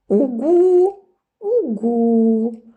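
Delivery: high, bright little-lamb voice, smiling; the sheep cheerfully repeats after the wolf. A narrator's reading. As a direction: chirp it happily like a small child. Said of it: "улыбнувшись, повторила овечка".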